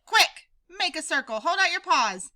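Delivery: high loud orders; rally the crowd fast; urgent commands. Acted. Pip ordering the prairie dogs into a circle, urgent shout.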